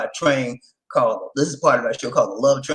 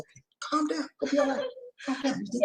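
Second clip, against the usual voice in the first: soft, high calming voice; calming a drunk friend softly; soothing; 12 semitones higher, 11 dB quieter than usual. Retold or imitated. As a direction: settle her down gently. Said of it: "I said".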